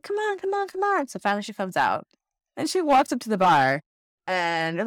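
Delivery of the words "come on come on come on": high-pitched, pleading nudge; coaxing. Retold or imitated. Friend urging a grumbly woman to come out, coaxing. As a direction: imitate a friend wheedling.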